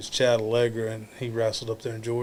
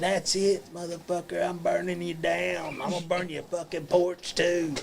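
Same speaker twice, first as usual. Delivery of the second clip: higher-pitched angry threats, not shouted; mad rant; 5 semitones higher, 3 dB quieter than usual. Retold or imitated. Wrestler retells the woman charging over the rail, mad rant. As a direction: imitate her furious threat at a raised pitch. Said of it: "She was so mad".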